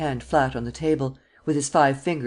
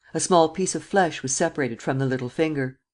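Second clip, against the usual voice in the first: usual speaking voice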